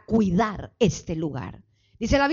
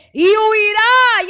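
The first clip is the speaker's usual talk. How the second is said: loud, high, slow proclamation; shouted scripture; 10 semitones higher, 9 dB louder, much slower than usual. Acted. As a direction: ring it out in triumph. (proclamación gritada y lenta)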